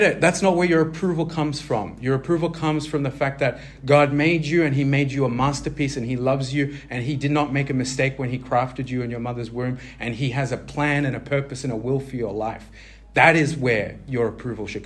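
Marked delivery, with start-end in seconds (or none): none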